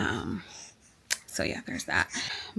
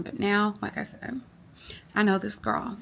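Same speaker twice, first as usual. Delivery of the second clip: normal talking voice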